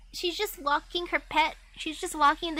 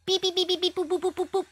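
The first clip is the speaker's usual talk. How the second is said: robot beeps; 6 semitones higher, faster than usual. Acted. Voicing robot knight's beeps.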